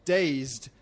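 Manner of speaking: usual speaking voice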